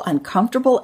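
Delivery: ordinary delivery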